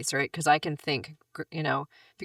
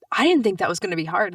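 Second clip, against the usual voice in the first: surprised client quote; 4 semitones higher, 7 dB louder than usual. Retold or imitated.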